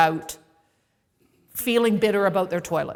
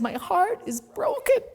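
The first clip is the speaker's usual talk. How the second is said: tearful; 10 semitones higher than usual. Retold or imitated. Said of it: "and I said".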